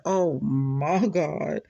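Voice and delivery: slow, drawn-out oh my God; slow retold gasp of surprise; mock shock. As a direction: stretch it out in disbelief. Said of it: "I said".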